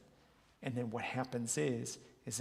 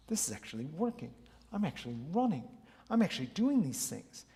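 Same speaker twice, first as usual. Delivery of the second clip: rising, louder thrill of realizing progress; mock excited self-talk; 3 semitones higher, 4 dB louder, faster than usual. Client cheering to himself, excited. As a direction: cheer yourself on, surprised it works. Inner voice finding success.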